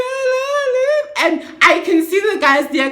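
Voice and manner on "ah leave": frantic plea. Retold or imitated. Begging the taxi to leave.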